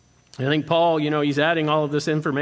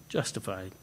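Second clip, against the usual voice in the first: low, soft justified after a small laugh; hushed, delighted justified; 9 semitones lower, 13 dB quieter than usual. Real, not acted. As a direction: let justified fall softly in wonder. Savoring key word with laugh.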